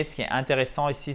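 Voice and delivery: normal talking voice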